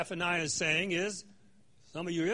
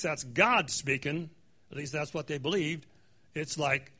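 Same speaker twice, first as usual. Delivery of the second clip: ordinary talk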